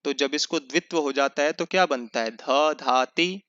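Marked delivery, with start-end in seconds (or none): 2.3-3.4 slow syllable-by-syllable ध धाति; teacher sounding out ध धाति; slow demo. Shown as a demonstration. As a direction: spell out ध धाति slowly, each syllable apart. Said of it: "जैसे धा धातु है".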